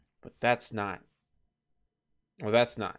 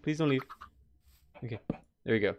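usual speaking voice

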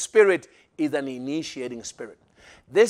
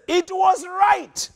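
exclaiming; 13 semitones higher, much slower than usual. Acted. Father declares feast.

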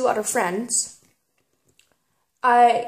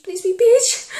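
eager plea; 11 semitones higher, 3 dB louder than usual. Real, not acted. Begging before tasting bean.

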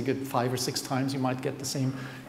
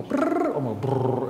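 humming buzz; slower than usual. Shown as a demonstration. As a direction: buzz it out like a hum. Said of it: "kind of do a humor".

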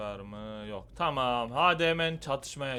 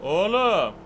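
shout; 8 semitones higher, 14 dB louder than usual. Real, not acted. Fight shout.

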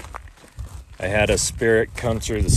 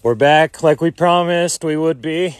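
calling out; 7 semitones higher, 6 dB louder than usual. Acted. Addressing spirits in the cemetery.

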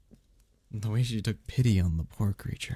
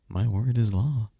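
normal talking voice

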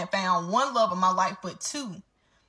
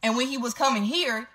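normal talking voice

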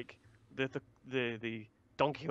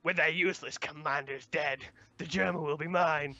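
put-on Big Mom voice. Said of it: "I don't know why I'm doing this voice".

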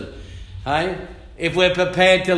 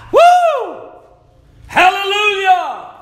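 high, loud hallelujah whoop; ecstatic cry; 6 dB louder, slower than usual. Real, not acted. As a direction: shout out in ecstatic praise.

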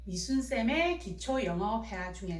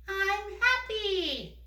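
put-on much higher, very slow child voice answering; 높은 아이 목소리; 7 semitones higher, much slower than usual. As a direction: answer slowly in a high little-girl voice. 미미 역할로 대답. (미미 역할로 높고 천천히 대답하는 목소리)

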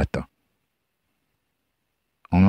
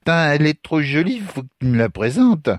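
high, tipsy admiration; drunk young man; 9 semitones higher than usual. Acted. Drunk stranger asks Lucas for an introduction, eager.

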